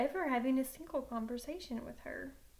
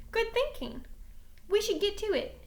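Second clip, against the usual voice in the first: put-on eager girl; 8 semitones higher than usual.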